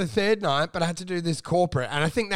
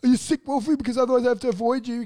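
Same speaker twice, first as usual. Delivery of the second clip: high, a bit louder, worried nagging; mock suspicious comic; 5 semitones higher, 3 dB louder than usual. Imitating the comic questioning if Wolfie is sick, high and worried. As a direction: fret at him like a germ-fearing pest. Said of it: "And he's like".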